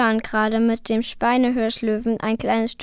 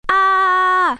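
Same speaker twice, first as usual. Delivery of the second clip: loud, high sustained shriek; long piercing cry of alarm; startled scream; 8 semitones higher, 9 dB louder than usual. A narrator's reading. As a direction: scream out in sudden fright. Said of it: "Plötzlich schrie Liv auf".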